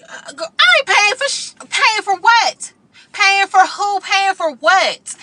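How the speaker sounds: high, loud, drawn-out indignant "paying for what?"; shrill-free but loud, stretched disbelief; indignant outburst. Retold or imitated. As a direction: blast back in outrage. Refusing to pay, heated.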